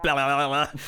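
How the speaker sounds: mumbled gibberish. Retold or imitated. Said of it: "he just kind of goes like".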